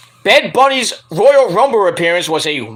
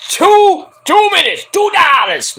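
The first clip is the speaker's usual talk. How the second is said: high, hammed-up yell; mock outburst; 11 semitones higher, 4 dB louder, slower than usual. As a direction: cry out the amount in mock outrage. Mock outburst over the figure.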